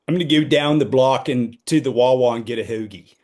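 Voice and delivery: trying out a Philly accent; deep Philly accent. Shown as a demonstration. Showing a friend how well they do deep Philly, Philly accent demo. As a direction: put on some deep Philly vowels, rounded O's. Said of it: "listen, tell me how I do".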